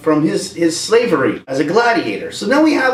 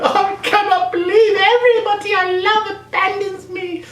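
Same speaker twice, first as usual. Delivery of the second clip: high, far-off whiny lament; mock lament. Rey lamenting everyone abandons her, high mock whine. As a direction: whine it like a hurt teen.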